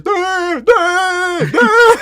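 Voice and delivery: very high, loud, droning duh; mentally ill stranger's duh. Retold or imitated. Imitating the stranger stepping out of the woods, high loud drone. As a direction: mimic the stranger's wordless drone. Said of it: "all I hear is".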